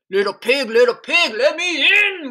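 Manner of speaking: big bad wolf calling out, rising loud on the demand; growling wolf. A narrator's reading. Hungry wolf demands that the little pig open up, growling. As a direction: growl it like a storybook wolf at the door. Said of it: "growled the wolf".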